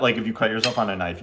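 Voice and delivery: normal talking voice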